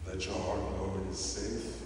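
ordinary delivery

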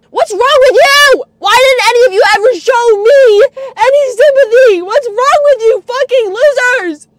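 high, loud, hurt outburst; anguished angry shouting. Real, not acted. Berating family who showed no sympathy, anguished shouting. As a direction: shout at them, hurt and furious.

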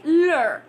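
"Lur" said loud with a curled r; stressed lur ending. Shown as a demonstration. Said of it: "like making it lur".

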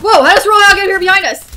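alarmed surprise. Real, not acted. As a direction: blurt it out in alarm. Surprised by enemy behind.

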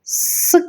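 «с» hissed alone without voice; isolated hiss. Shown as a demonstration. Teacher isolating the final consonant sound. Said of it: "Третій звук у слові".

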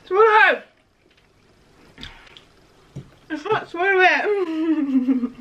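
talking through a stuffed mouth; words round a mouthful of food; mouth full. Real, not acted. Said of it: "I got so much in my mouth".

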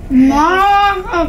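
burning mouth from spicy chips; gasping from the spice heat. Real, not acted. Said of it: "cala minha boca".

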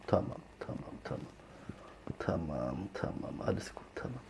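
soft soothing whisper. Real, not acted. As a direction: hush the kitten gently. Hushing kitten.

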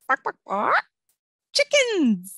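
high bright squealed reveal; playful reveal. Acted. Teacher revealing chickens to children, squealing high. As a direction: tease then squeal the answer.

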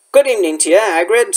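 usual speaking voice

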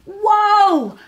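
high, loud cry of wonder; drawn-out high exclamation; amazed cry. A narrator's reading. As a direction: cry out like an awestruck little hedgehog. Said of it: "cried little Hedgehog".

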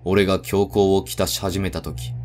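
ordinary delivery

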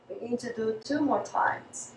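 ordinary delivery

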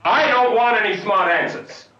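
loud, high-pitched outburst; angry shout. Acted. Director rebuking his actress for answering back, angry shout. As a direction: cut them off with a sudden yell.

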